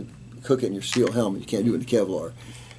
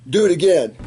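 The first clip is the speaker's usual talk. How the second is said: higher, louder indignant demand; angry demand; 8 semitones higher, 8 dB louder than usual. Retold or imitated. Losing captain demanding a rematch, loud and indignant. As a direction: bark it like a sore loser.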